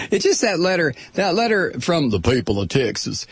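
mock Texan accent, lightly put on; phony Southern accent. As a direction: slip briefly into a fake Southern twang. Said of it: "adopt that phony-ass Southern accent".

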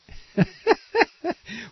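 high derisive laugh; scornful burst of laughter; mocking laugh. Real, not acted. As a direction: laugh it off as ridiculous. Laughing at the statement.

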